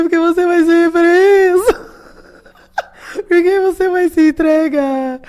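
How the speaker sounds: high, loud, slow crying plea; hammed-up sobbing question to the president; mock sobbing. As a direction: wail it through imitated tears. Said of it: "Chorando, assim".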